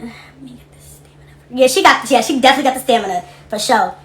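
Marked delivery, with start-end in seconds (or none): 0.0-1.2 breathy hushed aside; whisper. Real, not acted. Live host whispering to herself before reading.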